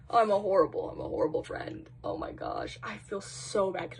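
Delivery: guilty whisper; breathy ashamed whisper. Real, not acted. Guilty self-reproach, whispered.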